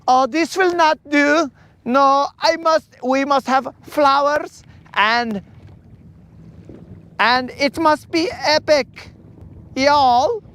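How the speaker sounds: put-on high-pitched, slow, fussy wedding planner accent; hammed-up movie wedding planner with a Southern y'all; Franz. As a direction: play a dramatic, accented event planner way up high. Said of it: "Franz's voice".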